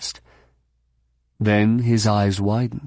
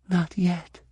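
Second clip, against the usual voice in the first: high, soft near-whisper; 8 semitones higher, 5 dB quieter than usual. A narrator's reading. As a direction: barely let the words out, small and high. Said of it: "Mara whispered".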